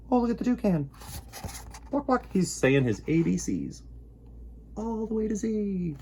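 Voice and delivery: high, bright look-at-that; delighted pointing. Acted. Host pointing out the toucan to children, delighted and high. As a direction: show the kids something wonderful.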